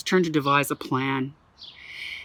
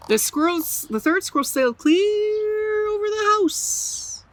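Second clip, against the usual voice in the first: a much higher, slow stretched voice; soaring high voice; 14 semitones higher than usual. A narrator's reading. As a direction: let it fly up and away. Squirrel flying high.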